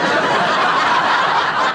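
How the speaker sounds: real giggling laugh, loud; high breathy laugh. Real, not acted. Teller laughing at the horseradish sauce line. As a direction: laugh at the punchline.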